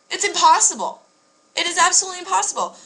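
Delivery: high and emphatic, pressing the point; insistent. Real, not acted. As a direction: hammer home that it can't be done. Insisting guys always mock.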